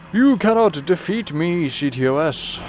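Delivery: slow, hammed-up taunt of a triumphant villain; mock villain boast. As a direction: lightly gloat like a cartoon baddie. Taunting the game system.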